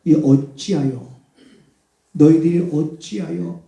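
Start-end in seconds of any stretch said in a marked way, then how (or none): none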